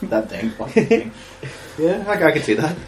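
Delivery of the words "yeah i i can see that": ordinary talk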